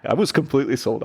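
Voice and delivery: ordinary delivery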